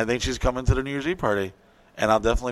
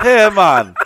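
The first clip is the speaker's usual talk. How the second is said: higher, louder hammed-up Jamaican accent as the Coast Guard; loosely done Jamaican accent calling out; mock Jamaican accent; 5 semitones higher, 9 dB louder than usual. Coast Guard hailing Wilson, hammed-up Jamaican accent. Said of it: "Whoopi Goldberg's Jamaican now".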